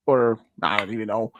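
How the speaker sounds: ordinary delivery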